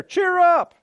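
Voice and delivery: very high, squealed rallying cry; exuberant exhortation. Acted. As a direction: pipe it up high to rouse the room. Acts talking to oneself in mirror.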